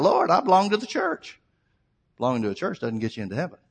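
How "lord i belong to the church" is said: high-pitched retold cry to God. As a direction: cry out in protest, imitating a desperate plea. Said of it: "He started crying out".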